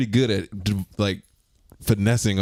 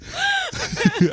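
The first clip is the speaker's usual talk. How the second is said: high squealing laugh. Real, not acted. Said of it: "That's terrible".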